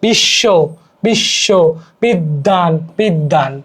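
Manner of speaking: বিশ্ব as "bish-sho", বিদ্যান as "bid-daan"; doubled consonant. Shown as a demonstration. Teacher illustrating doubling, held consonants in বিশ্ব, বিদ্যান. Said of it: "সংযুক্ত বর্ণের উচ্চারণ দ্বিত ঘটে".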